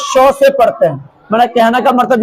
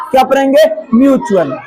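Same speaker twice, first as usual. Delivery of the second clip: ordinary delivery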